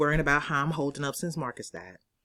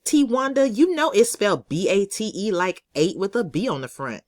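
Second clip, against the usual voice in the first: higher, louder bossy schoolgirl voice; know-it-all classmate Sarah; 6 semitones higher, 5 dB louder than usual. Acted. Sarah correcting T Wanda on a spelling, high and louder. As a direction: play the smug girl who's sure she's right. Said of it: "Sarah said".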